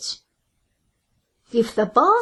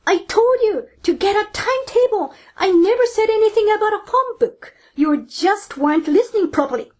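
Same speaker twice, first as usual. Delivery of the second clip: mild mock shout; 7 semitones higher than usual. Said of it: "the boss shouts".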